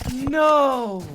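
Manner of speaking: stunned no. Real, not acted. Caster reacting to clutch.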